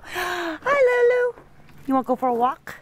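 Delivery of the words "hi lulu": high, loud delighted call; sudden joy at seeing the dog; excited greeting. Real, not acted. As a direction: cry out her name in delight.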